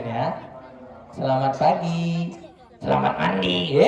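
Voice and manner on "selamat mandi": put-on a low, cheeky puppet boy getting the greeting wrong. Ventriloquist's puppet Ucup mangles the greeting, low voice. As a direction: drop the voice low like a naughty puppet.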